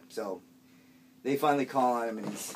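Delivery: ordinary talk, nothing marked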